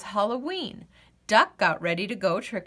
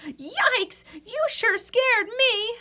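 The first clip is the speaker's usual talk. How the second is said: much higher surprised cry; startled yelp; 13 semitones higher, slower than usual. Acted. Swamp monster startled by the boo, high yelp. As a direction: jump back startled. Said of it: "the swamp monster quacked".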